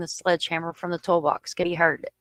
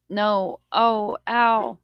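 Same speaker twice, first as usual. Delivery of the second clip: read-out cries of pain, slightly raised; restrained mock cry, a little higher; mild retold scream; 3 semitones higher, 3 dB louder, much slower than usual. A narrator's reading. Victim struck with the hammer, mildly acted scream. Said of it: "Ledford screams".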